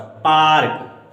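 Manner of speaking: पार्क with the aa stretched long and slow; पार्क, aa held long. Shown as a demonstration. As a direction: draw out the aa in पार्क. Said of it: "इसका खिंचाव काफी लंबा हो रहा है".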